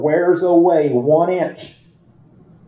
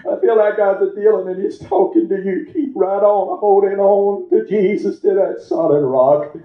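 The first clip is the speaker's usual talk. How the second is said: raised, pressing preacher voice; preaching; 6 semitones higher than usual. Acted. Preacher urging the congregation to hold on, raised voice. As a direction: drive each line at the congregation.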